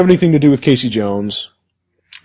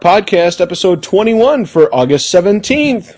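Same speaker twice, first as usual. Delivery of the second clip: ordinary talk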